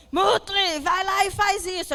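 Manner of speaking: ordem alta e mais aguda, como o Dick Vigarista; vilão mandão. Retold or imitated. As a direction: imite o vilão dando ordens ao cachorro, mais alto. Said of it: "ele manda ele fazer alguma coisa". (imitando o Dick Vigarista mandando o Muttley, ordem mais alta)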